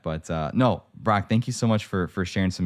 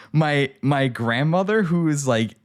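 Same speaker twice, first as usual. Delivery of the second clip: incredulous panic; 6 semitones higher, 3 dB louder, slower than usual. Retold or imitated. Retold inner panic.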